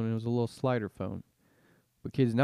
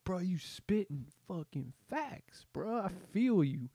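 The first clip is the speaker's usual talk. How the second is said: higher, slow, dazed bro drawl; stoned bro voice; 8 semitones higher than usual. Pretend. One vein-linked friend praises the other, dazed bro drawl. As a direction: gush like a zoned-out friend. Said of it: "you're just like".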